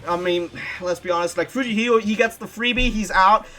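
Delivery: loud, high excited pitch; rally them to vote. Real, not acted.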